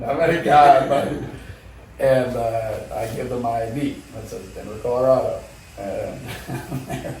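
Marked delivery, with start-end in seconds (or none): 0.0-1.3 pleading repeat. Retold or imitated. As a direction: drag out the word American pleadingly. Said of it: "I start saying".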